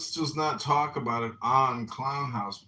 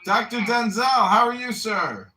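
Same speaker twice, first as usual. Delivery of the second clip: much higher and louder; booming greeting; 7 semitones higher, 9 dB louder than usual. Real, not acted. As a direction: hail the doctor across the room. Greeting Doctor Denzel joining.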